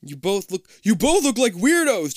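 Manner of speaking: high, loud panicked scream. Acted. Jason screaming at the twins and friend in a loud panic. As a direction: shriek it at the two of them. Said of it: "Jason screams".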